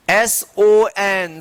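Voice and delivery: slow spelling. Shown as a demonstration. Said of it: "देखिए".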